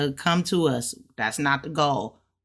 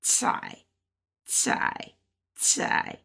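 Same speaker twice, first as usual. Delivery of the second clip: teacher's demo of "cai" going down; fourth-tone CAI; 5 dB quieter, much slower than usual. Shown as a demonstration. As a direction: say "cai" with a falling tone and a hissed ts onset. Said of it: "fourth tone".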